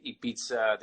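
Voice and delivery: Ibiza with a ts, "ee-BEET-sa"; German pronunciation. Shown as a demonstration. Guest compares nations for host, German Ibiza with ts. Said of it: "The Germans say Ibiza".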